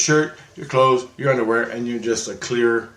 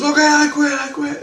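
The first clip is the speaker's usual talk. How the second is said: very high, loud mimicked surrender cry; mock pleading yelp; 6 dB louder than usual. Beaten opponent begging the storyteller to stop, high mock yelp. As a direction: imitate a panicked squeal of giving up.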